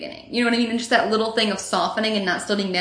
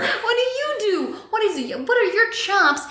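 pitched way up and slowed, scornful; scoffing falsetto challenge to the guitar guy; mocking challenge; 11 semitones higher, slower than usual. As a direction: sneer the question up high. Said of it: "I'm like".

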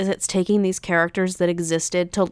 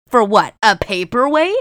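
mock outrage; 3 semitones higher, 7 dB louder than usual. Ranting at the writers over the paperweight, loud indignant. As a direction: spit it out in comic disbelief. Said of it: "the rage that boiled in my veins".

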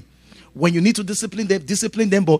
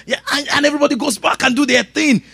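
usual speaking voice

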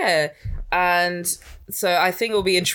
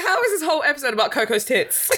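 high-pitched, laughing incredulity; amused disbelief; 8 semitones higher than usual. Retold or imitated. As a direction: retell your disbelief high, nearly giggling. Retelling her disbelief, amused.

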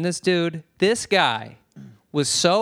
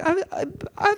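high-pitched stammer; flustered protest; faster than usual. Retold or imitated. Acting out reply to scolding counter girl, high flustered stammer. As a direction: stumble over it in a squeaky mock protest.

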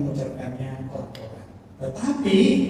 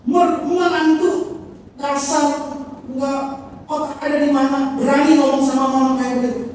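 much higher, louder outraged rant; angry scolding; 8 semitones higher, 5 dB louder than usual. Retold or imitated. Mother-in-law scolds the rude daughter-in-law, loud and outraged. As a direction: scold her with raised voice.

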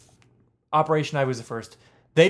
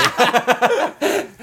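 high burst of laughter; loud laugh; 10 semitones higher, 5 dB louder than usual. Real, not acted. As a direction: crack up loudly.

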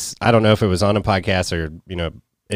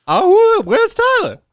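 very high, louder squeal; mock Madea squeal; 6 dB louder, much slower than usual. Hunting for Tyler, mock Madea squeal. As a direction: shriek like Madea calling.

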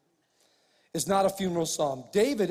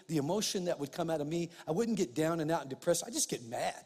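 ordinary talk, nothing marked

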